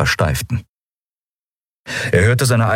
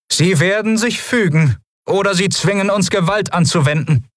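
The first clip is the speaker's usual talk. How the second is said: much higher, formal officer's voice; female lieutenant voice; 13 semitones higher than usual. Acted. Lieutenant announcing herself to the admiral, in a high formal officer voice.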